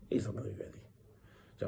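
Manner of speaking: whispered aside to the viewers. Shown as a demonstration. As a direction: drop to a hushed whisper. Repeats the line, dropping to a whisper.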